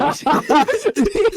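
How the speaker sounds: shrill burst of laughter; high squealing laugh. Real, not acted. Laughing at misheard steaks.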